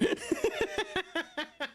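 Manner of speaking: high staccato giggle; laughing. Real, not acted. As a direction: giggle helplessly in quick pulses. Cracks up while bantering with chat.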